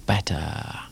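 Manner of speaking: unvoiced throwaway whisper to end the poem; whispered punchline. Poet ending his poem for the audience, whispered. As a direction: drop it to a secret whisper.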